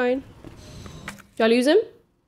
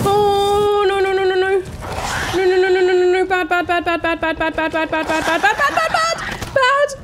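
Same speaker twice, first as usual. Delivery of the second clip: loud, high, rapid-fire repetition; real panicked babble at full volume; frantic panic; 6 semitones higher, 10 dB louder than usual. Real, not acted. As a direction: rattle it off in alarm, shooting higher at the end. Fleeing danger in the game.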